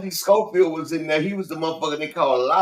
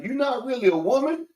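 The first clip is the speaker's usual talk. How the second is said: mock questioning; 9 semitones higher than usual. Acting out movie dialogue.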